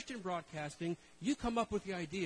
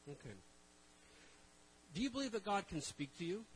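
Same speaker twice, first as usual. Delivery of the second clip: usual speaking voice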